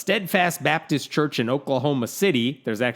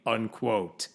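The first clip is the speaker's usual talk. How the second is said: ordinary talk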